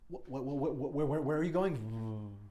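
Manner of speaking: high, loud stuttering panic in a put-on voice; stammering panic. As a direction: trip over the words in terror.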